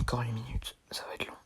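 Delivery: soft unvoiced whisper. Real, not acted. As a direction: breathe the words, barely audible. Chuchote devant la caméra. (chuchoté)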